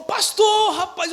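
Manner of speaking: excited greeting. Retold or imitated. Old friend spots pastor.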